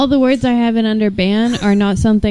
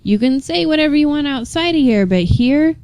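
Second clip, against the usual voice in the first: normal talking voice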